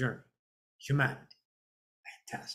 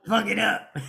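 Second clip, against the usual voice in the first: Chris Rock. Retold or imitated. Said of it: "Little Chris Rock?".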